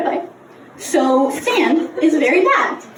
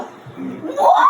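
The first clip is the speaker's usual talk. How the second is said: shocked; 9 semitones higher than usual. Retold or imitated. Mom reacts to Vespa crash.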